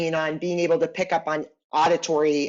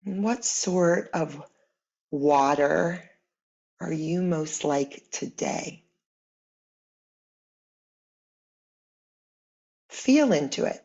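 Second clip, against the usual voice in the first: guided meditation; 3 semitones lower, 4 dB quieter than usual. Acted. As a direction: guide them gently inward, unhurried, letting each phrase settle. Leads guided imagery meditation.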